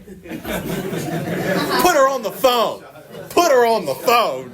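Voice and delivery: high, drawn-out mock yell; pleading, high-pitched outburst; retold insistent demand. Acted insistent demand in retold call.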